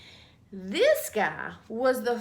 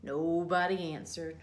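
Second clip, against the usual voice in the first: ordinary delivery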